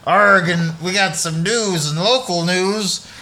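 slow pirate drawl, lightly done. Pretend. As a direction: talk like an old sea pirate. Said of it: "keep this up way longer".

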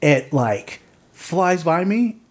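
ordinary talk

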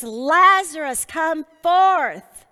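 calling out loud and high into the tomb; loud cry. A narrator's reading. Jesus calling Lazarus out of the tomb, loud cry. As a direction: cry it out with full voice. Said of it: "he cried with a loud voice".

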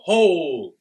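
loud booming command. Acted. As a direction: bellow it across the square.

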